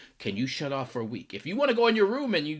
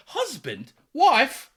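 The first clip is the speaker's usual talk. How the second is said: hammed-up British, pitched up on the reply; exaggerated British accent; 12 semitones higher, 6 dB louder, much slower than usual. Friends addressing each other as spouses, exaggerated British accent. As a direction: call out in a silly British accent. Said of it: "exaggeratedly hilarious British accents".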